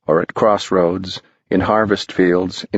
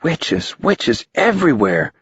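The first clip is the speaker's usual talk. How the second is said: amazed; 3 semitones higher than usual. A narrator's reading.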